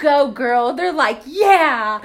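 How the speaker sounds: loud cheer for the runners. Retold or imitated. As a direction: call out encouragement, loud. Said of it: "And whenever we're like".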